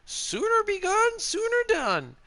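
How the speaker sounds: high, drawn-out woman's line; quoting game character. Acted. Streamer quizzing viewers on a Skyrim woman's quote, high character voice. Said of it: "Which character goes".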